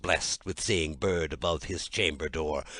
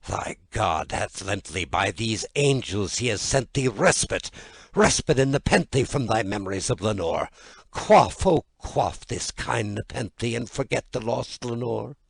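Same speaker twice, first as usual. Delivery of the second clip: rising, louder and higher plea; urgent pleading; 4 semitones higher, 6 dB louder than usual. Acted. Narrator urges himself to forget Lenore, pleading. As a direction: press it urgently.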